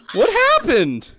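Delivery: loud, high-pitched bewildered shouting; indignant high shout at the laughter; bewildered yelling. Real, not acted. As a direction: yell it in baffled protest. Baffled at being laughed at.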